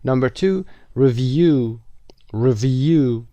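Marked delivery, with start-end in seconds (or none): 1.0-1.8 "review" with -view stressed; stress on second syllable. Shown as a demonstration. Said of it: "Second syllable". 2.3-3.3 "Review" with a strong -view; stress on second syllable. Shown as a demonstration. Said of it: "Second syllable".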